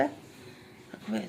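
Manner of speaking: breathless whispered amazement, slow. Real, not acted. Mother whispers amazement at daughter's hair. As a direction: gasp it out under your breath.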